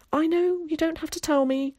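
put-on high, boyish pre-empting; knowing protest. A narrator's reading. Boy heads off Tom's warning, in knowing protest.

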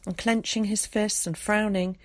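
usual speaking voice

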